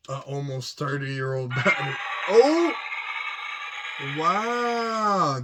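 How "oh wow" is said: surprised. Real, not acted. Toy suddenly lights up.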